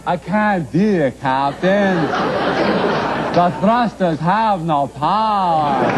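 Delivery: slow, drawn-out starship engineer; Scotty. Acted. Host mocking his guest's accent, hammed-up slow Scotty impression. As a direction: imitate a Star Trek engineer, dragging the words out. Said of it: "sound like that Irish guy in Star Trek".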